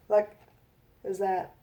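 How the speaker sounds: ordinary delivery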